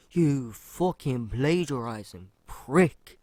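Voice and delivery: loud, drawn-out angry accusation; hammed-up furious insult; mock outraged call-out. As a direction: yell it slowly, leaning on every word. Acts out calling out a thief.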